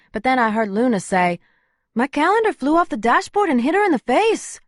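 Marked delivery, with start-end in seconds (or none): 1.9-4.6 much higher, brisk woman demanding; Luna's voice. Acted. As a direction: take charge of the crowd. Luna's voice held.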